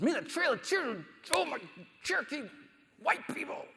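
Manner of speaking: a high, squealing mock rant; mock outburst. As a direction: blurt it out in a hammed-up shrill voice. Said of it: "what I really wanna say".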